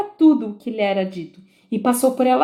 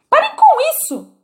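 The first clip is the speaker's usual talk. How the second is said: very high, loud yell; bellowing; 5 dB louder than usual. A narrator's reading. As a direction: yell it, exasperated. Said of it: "berrou a rainha". (a rainha berrando, muito aguda e alta)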